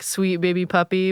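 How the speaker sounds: ordinary talk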